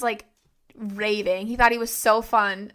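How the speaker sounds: usual speaking voice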